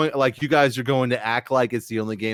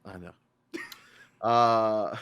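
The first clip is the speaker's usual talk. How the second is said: normal talking voice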